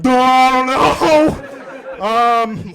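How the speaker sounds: slow flustered laugh at the table. Retold or imitated. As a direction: play it bashful and caught off guard. Flustered at flirty waitress.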